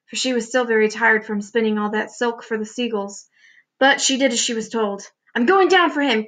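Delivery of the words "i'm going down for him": higher, determined call; urgent cry. A narrator's reading. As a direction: cry out a bold decision. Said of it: "cried James".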